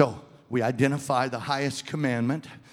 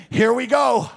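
louder rally cry; rallying call; 11 semitones higher, 8 dB louder than usual. Pretend. Pastor voicing the self rallying its inner parts, loud call. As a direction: call the troops to move out. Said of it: "you've gotta say".

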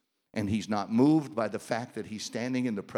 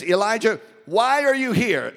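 booming God; 9 semitones higher, 7 dB louder than usual. Acted. God questions Elijah.

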